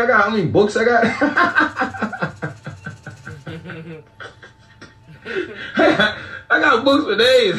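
words squeezed out between big laughs, slowed down; can barely get it out for laughing; laughing hard. Real, not acted. Joking with a friend about toilet paper, laughing through it. Cracking up at his own joke.

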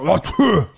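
imitated explosive sneeze; big, throaty mock sneeze; violent sneeze. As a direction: exaggerate a violent achoo. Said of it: "it was like a violent sneeze".

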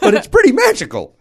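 squealing high with delight; excited. Real, not acted. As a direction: gush about the magic, high and loud. Gushing about magic trick.

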